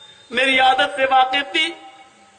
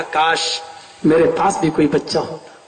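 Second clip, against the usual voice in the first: much lower, fast wistful sigh of a wish; wistful longing; 11 semitones lower than usual. Acted. Father wishes for a child.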